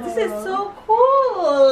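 high, slow squeal of delight; delighted gushing. Real, not acted. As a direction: stretch it out, thrilled by a gift. Opening a gift.

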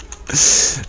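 short breathy chuckle; real unvoiced little laugh. Real, not acted. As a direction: laugh briefly at your own mistake. Laughs at own typing fail.

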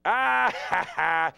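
mocking laugh.

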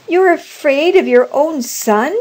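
high, loud, challenging question; indignant cry. A narrator's reading. Old woman struggling with her frightened husband, indignant cry. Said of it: "she cried, struggling".

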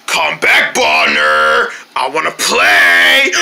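low, slow, creepy call; drawn-out sinister invitation to play; scary Tun. Acted. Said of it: "not the scary Tun".

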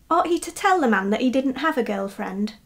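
ordinary delivery, nothing marked

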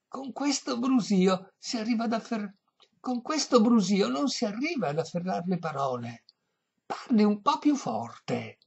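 put-on high-pitched, complaining old-lady voice; deaf old lady. A narrator's reading. Deaf lady asking violinist to speak up, high voice. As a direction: complain high over the din.

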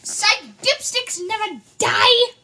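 triumphant shout. Acted. Triumphant reveal after fake injury.

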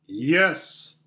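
lightly acted great lion's voice. Lion answers from behind their backs, great voice. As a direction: answer with weight and grandeur. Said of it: "said a great voice".

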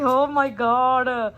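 ordinary delivery

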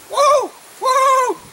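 high drawn-out whoa; startled whoa. Real, not acted. Reacting to the horse, high startled whoa. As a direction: let out a high, surprised whoa.

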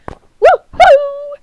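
high, loud woohoo; excited squealed cheer; gleeful whoop. Real, not acted. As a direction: let out a high victory whoop. Celebrating in the game.

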